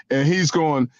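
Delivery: usual speaking voice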